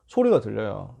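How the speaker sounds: ordinary delivery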